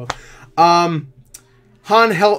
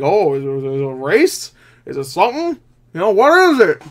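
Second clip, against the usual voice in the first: imagined confused viewer, growing more insistent; puzzled questioning; 3 semitones higher, 4 dB louder than usual. Acted. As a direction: build to an exasperated, baffled question.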